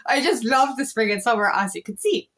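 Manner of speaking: normal talking voice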